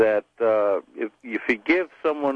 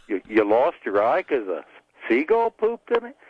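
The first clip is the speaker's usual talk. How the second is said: incredulous question. Acted. Man at the bar doubting the pirate, incredulous. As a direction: question it as if you can't believe it.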